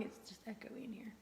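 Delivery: sudden hushed whisper. Real, not acted. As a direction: drop to a whisper. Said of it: "Baby, inside voice".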